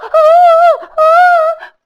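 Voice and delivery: loud high straining cries; piercing battle squeaks; high effort cries. Acted. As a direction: squeal while biting hard. Rikitiki biting in the fight.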